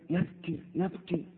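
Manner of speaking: repeated word dropping almost to a whisper; fading whisper. Retold or imitated. Preacher echoing the weeping man, fading softly.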